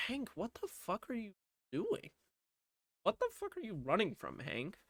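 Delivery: soft, high-pitched incredulous voice; mock bafflement. Jeff in security office watching Hank, mock bafflement. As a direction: quietly puzzle at him, hammed up.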